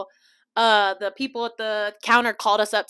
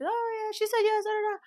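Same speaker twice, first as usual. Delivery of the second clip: high sing-song announcer voice; lightly mocking high announcement; 12 semitones higher, 3 dB quieter than usual. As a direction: sweetly mimic the cabin crew. Mimicking the flight announcement.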